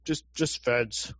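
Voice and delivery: soft, slow dismissive murmur; deadpan. Real, not acted. Dismissing the fed label to the co-host, slow and soft. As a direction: let it drop flat and slow. Deadpan after his outburst.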